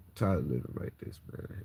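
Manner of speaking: sinking into quiet despair; weary despair. Real, not acted. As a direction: let it drop to an exhausted murmur. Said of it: "I'm just highly emotional right now".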